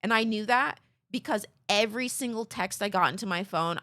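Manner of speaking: ordinary talk, nothing marked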